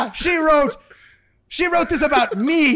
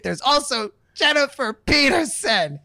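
usual speaking voice

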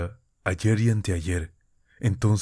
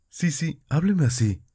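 voz de muchacha más aguda, suave; pídele con ternura que siga hablando; Nastenka, delicada; 9 semitones higher than usual. A narrator's reading. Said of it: "dijo Nastenka con un gesto delicado". (voz aguda y delicada de Nastenka)

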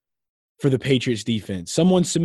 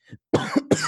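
harsh chesty cough; a hard cough from the chest; 3 dB louder than usual. Real, not acted. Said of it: "I'm coughing because I'm so heated".

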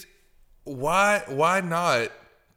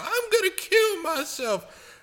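higher, slow mock dramatic teen; 14 semitones higher than usual. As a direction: parody the suicidal-teen trend. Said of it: "it started this whole trend of".

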